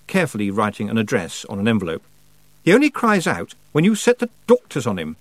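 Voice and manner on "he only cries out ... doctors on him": put-on boy's voice. Roland retorts.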